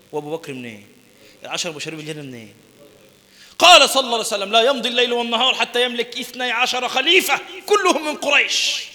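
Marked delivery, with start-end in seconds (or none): 3.6-8.8 high, loud, ringing hadith recital; preaching at full force. Acted. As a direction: proclaim it like a sermon peak.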